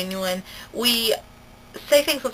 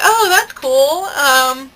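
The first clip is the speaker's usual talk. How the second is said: fake polite interest; 5 semitones higher, 4 dB louder, slower than usual. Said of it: "we were like".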